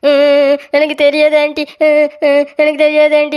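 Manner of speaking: high whimpering wail; acted crying, pitched high; tearful denial. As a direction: whine it out between sobs. Accused child denies it in tears.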